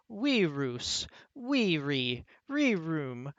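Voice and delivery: each form separate, falling in the same tune; paradigm recitation. Shown as a demonstration. Professor drilling singular forms for the class, recited in a list. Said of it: "go over the singular forms".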